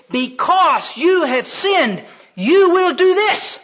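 slow, high, loud divine sentence; thundering judgement. Acted. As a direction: pronounce doom slowly, building to a shout. God pronounces judgement, acted.

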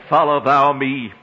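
each word weighted and drawn into a chant; emphatic command. Acted. Preacher quoting Jesus to the congregation, emphatic and chanted. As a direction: press down hard on "Follow thou me". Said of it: "the Greek of that is so emphatic".

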